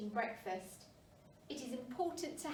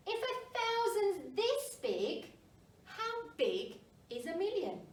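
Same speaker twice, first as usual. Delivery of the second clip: higher wondering question; 8 semitones higher than usual. A narrator's reading. Pipkin's repeated question.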